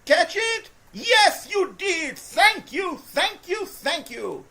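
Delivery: high, loud, thankful cheer; overjoyed thanks to the receiver; relieved excited shout. Real, not acted. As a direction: celebrate the catch. Pass finally caught.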